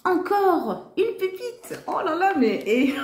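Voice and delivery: high, excited squeal over a new find; squealing delight. Real, not acted. She squeals with joy at finding another gem.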